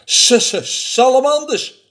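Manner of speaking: hissed s's bursting into a high, loud cry; alarmed snake exclamation; startled snake. Acted. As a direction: stutter the s like a snake, then exclaim.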